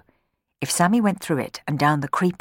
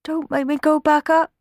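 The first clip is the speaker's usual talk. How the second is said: put-on higher, small pleading boy voice; whimpering boy; 11 semitones higher, 3 dB louder than usual. Sammy begging the children, higher whimpering plea. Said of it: "whimpered Sammy".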